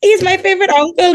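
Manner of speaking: high-pitched, delighted cheer for family; excited shout-out. Real, not acted. Excited shout-out to favorite uncle.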